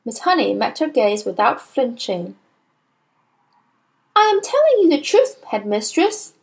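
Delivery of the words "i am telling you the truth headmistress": high, quick insistence; higher-voiced teacher standing firm; earnest teacher. A narrator's reading. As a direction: insist on being believed.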